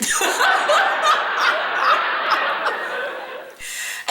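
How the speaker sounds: high, breathless burst of laughter; real laugh at the recap. Real, not acted. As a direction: crack up, laughing hard. Host cracks up at the story.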